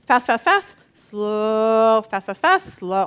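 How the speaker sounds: fast fast fast, then slow drawn out; heartbeat rhythm. Shown as a demonstration. Preacher imitating an irregular heartbeat, rhythmic. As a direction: tap out an uneven heartbeat with your voice. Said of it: "that's how he said it".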